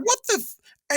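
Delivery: high, mock-baffled "what the"; indignant disbelief at the courier; retold disbelief. Retold thought, courier ignored him.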